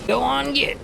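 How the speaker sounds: high, called-out shoo; calling out shooing. Retold or imitated. Streamer showing his shooing call at work, high calling voice. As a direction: shoo someone off in a put-on voice. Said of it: "I occasionally do the".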